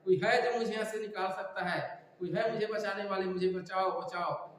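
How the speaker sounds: ordinary delivery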